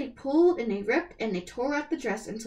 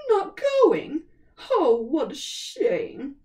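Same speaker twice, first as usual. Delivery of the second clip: high, louder then slow fake pity; mocking; 4 semitones higher, 6 dB louder, much slower than usual. A narrator's reading. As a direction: drip with false sympathy. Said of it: "with a mocking smile".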